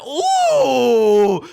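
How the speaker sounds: loud, high drawn-out ooh; sudden loud ooh of delight; excited ooh. Real, not acted. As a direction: let out a thrilled ooh. Reacting to a tile draw.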